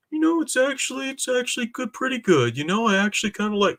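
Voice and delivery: high, airy voice of fans who suddenly approve; mocking fickle fans. Streamer mocks online critics who will soon praise the movie, in a high, sing-song mock voice. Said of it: "are gonna start saying".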